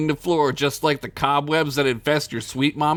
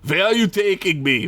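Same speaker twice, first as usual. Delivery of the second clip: put-on Russian accent; 6 semitones higher than usual. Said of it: "I gotta do a Russian accent now?".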